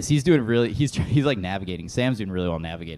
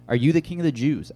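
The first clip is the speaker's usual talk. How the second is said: a hint of a stern Pilate, raised and higher; voicing Pilate; 5 semitones higher, 6 dB louder than usual. Pastor reading scripture voices Pilate questioning Jesus, mildly raised. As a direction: Pilate demanding, lightly acted and louder.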